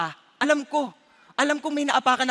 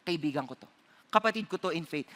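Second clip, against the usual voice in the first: much softer, lower murmur to oneself; quiet inner resolve; 6 semitones lower, 8 dB quieter than usual. Retold or imitated. Believer telling himself the enemy is a brother, hushed. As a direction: say it softly as a private vow. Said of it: "inner resolve about enemies".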